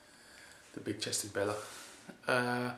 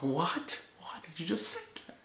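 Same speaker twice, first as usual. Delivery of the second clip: high whispered what, rising; mock stunned double-take; shocked disbelief; 7 semitones higher than usual. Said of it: "And then I was like".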